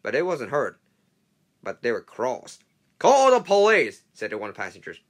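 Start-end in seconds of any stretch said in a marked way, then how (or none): 3.0-4.0 loud, higher, slow demand; demanding shout. Acted. Angry passenger demanding the police, shouting.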